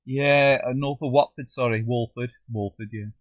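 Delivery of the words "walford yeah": ordinary delivery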